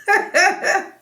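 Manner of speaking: laughing through the word; a laugh bursting in; real laugh. Real, not acted. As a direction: breaks into a high laugh. Laughing mid-retelling.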